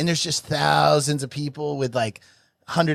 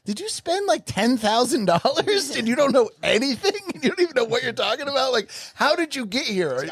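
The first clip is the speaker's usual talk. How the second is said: much higher, disbelieving rant; can't believe what he's hearing; mocking a clueless fan who paid a fortune, high and incredulous; 10 semitones higher than usual. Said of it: "and I'm like".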